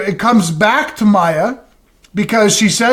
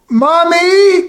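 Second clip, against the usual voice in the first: put-on a touch of a higher child's call; 8 semitones higher than usual. Child calling for mom at three in the morning, lightly voiced. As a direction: call out like a kid in the night.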